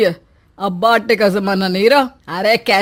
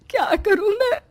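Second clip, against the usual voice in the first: tearful plea; 10 semitones higher, 4 dB quieter than usual. Acted. Mother-in-law crying to her son, breaking down. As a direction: voice cracking into a cry. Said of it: "माँ रोने लगती है".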